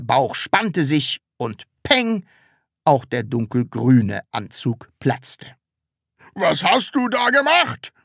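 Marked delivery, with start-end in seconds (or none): 6.3-7.9 high-pitched, angry outburst; angry cry. A narrator's reading. Department head sees the burst suit, angry cry. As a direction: cry out in rising outrage. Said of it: "schrie der Abteilungsleiter zornig".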